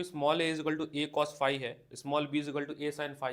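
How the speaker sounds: words drawn out one by one for writing down; teacher dictating a textbook question; slow dictation. Shown as a demonstration. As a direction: read the question out slowly for the class. Dictating the assumptions.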